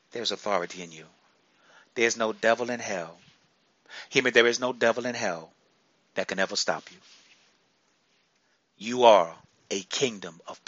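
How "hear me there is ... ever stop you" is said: normal talking voice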